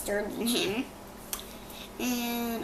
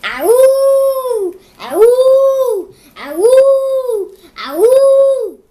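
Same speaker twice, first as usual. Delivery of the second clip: long, high, loud howls; wolf howls; 13 semitones higher, 17 dB louder, much slower than usual. Retold or imitated. Child retelling the wolves calling back, long high howls. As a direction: howl like a pack of wolves. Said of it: "Wolf called back".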